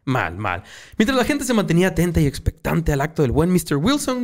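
normal talking voice

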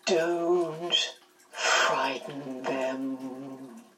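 low, soft, very slow warning; Missus Witch. A narrator's reading. Missus Witch cautioning Missus Watson, low, soft and drawn-out. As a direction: draw each word out long and hushed. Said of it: "Missus Witch's voice came".

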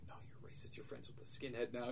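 hushed classmates' retort; soft, gossipy quote; retold whispered accusation. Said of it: "they're kinda whispering back and forth".

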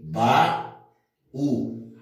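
"baú" said ba... ú, with a clear pause; teacher dividing "baú" as ba, then ú; split into syllables. Shown as a demonstration. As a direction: break "baú" into two separate syllables. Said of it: "eu vou dividir aqui".

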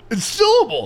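syllable said high as SIL-uh-bull; exasperated correction. Shown as a demonstration. Host correcting cohost's mispronunciation, exasperated. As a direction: hit the first beat of syllable, SIL-uh-bull. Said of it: "What the fuck are you talking about".

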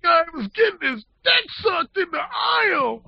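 hammed-up kid bragging the story; teen stock boy. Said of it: "he's telling somebody that story".

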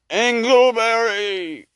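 slow high drawn-out ghost call; ghostly wail. Acted. Ghost calling the miser by his first name, wailing. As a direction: moan the name like a haunting spirit.

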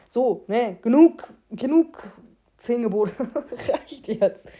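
laut rufend abbrechen. Real, not acted. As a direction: energisch Schluss machen. (lautes Genug)

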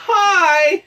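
high, loud chirpy greeting; bright squeaky hi. Pretend. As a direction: chirp a cheery hello.

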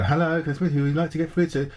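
high, bright mock receptionist voice. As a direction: play a chirpy receptionist, pitched well up. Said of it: "the receptionist speaker".

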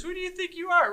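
high, laughing mock rebuke; mock scolding through laughter. Chief teasing an ambitious CSO, laughing mock rebuke. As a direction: tease them with a giggling scold. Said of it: "I would say the same thing".

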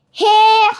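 sudden loud gruff bark of a pirate captain; pirate captain roaring Here; horrible voice. A narrator's reading. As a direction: blast it out like a nasty pirate. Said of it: "came a horrible voice".

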